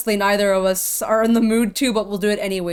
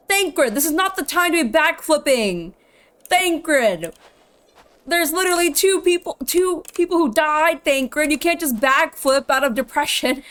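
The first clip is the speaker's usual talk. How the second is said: higher, exasperated telling-off; mock scolding; 5 semitones higher, 3 dB louder than usual. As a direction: scold him in playful outrage. Mock-scolds Thancred for backflipping.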